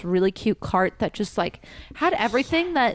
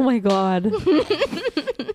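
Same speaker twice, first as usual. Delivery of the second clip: laughing; 5 semitones higher, much slower than usual. Real, not acted. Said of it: "laughing reaction".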